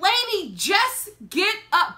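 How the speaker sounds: high, exasperated retold plea. Onlooker urging the lady to stand, high exasperated plea. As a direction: plead high, dragging the last words.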